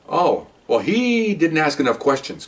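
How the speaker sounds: normal talking voice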